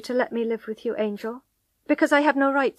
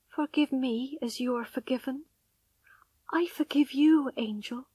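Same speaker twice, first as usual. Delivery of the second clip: hushed pleading; 4 semitones higher than usual. A narrator's reading. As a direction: beg gently under your breath. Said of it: "she said again".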